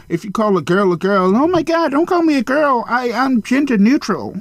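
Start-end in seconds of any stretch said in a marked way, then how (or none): 1.3-4.3 high, huffy mock outrage; high-pitched mocking whine; mock offended voice. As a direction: parody someone taking offense, pitched way up.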